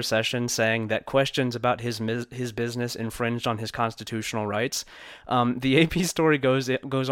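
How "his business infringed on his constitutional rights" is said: ordinary delivery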